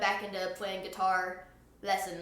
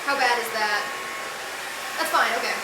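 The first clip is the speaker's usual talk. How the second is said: raised voice over the blow dryer; over hair dryer; 4 semitones higher, faster than usual. Real, not acted. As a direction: call out over the noise. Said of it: "And if I scream, I think y'all can hear".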